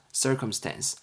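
normal talking voice

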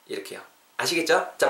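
ordinary talk, nothing marked

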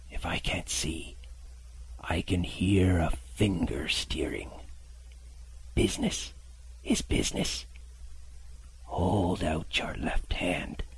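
slow, hissing blind beggar's whisper; whispered menace. Acted. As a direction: breathe the threat softly. Beggar threatens the captain.

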